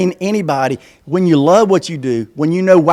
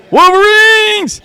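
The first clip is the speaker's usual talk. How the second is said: high, drawn-out rallying yell; Red Dawn battle cry; 5 dB louder, much slower than usual. Retold or imitated. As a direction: holler it like the movie cry. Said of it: "They've been watching Red Dawn".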